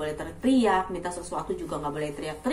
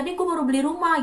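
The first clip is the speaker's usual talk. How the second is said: higher-pitched mock boast; proud bragging; 7 semitones higher, faster than usual. Indonesian boasting of a new house, proud bragging. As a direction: announce it like a show-off. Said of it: "dengan bangga menyebutkan harga rumah kita".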